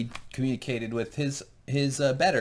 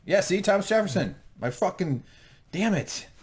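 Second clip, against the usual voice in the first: higher, excited mock frustration; mock exasperation; 6 semitones higher than usual. Real, not acted. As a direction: throw up your hands about Jefferson.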